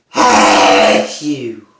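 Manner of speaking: big explosive sneezes; loud sneezes. Real, not acted. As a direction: sneeze hard, one after another. Filming an afternoon sneezing fit.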